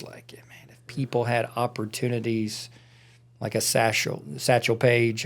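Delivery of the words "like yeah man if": whispering. Real, not acted. Hushed musing aside.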